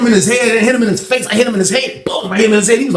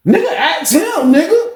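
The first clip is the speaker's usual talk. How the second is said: high-pitched, drawn-out challenge; retold heated demand; 13 semitones higher than usual. Inmate confronting another over his little brother, heated demand. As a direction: throw it out high and stretched.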